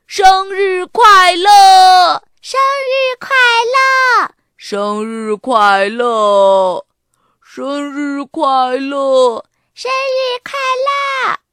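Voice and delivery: drawn-out loud cheer; 一起喊. A narrator's reading. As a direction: shout a surprise greeting, stretched out slowly. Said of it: "大家一起喊". (拖长声音齐声欢呼)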